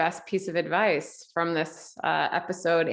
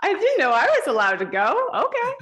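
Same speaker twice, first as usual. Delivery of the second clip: high-pitched, playful disbelief; much higher, louder, giddy surprise; mock surprise; 12 semitones higher, 3 dB louder than usual. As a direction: squeal it like an unexpected invitation. Acts out her surprised reaction.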